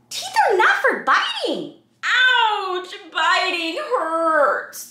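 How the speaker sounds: high loud playful ouch; hammed-up ouch; emphatic refrain. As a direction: make the rule big.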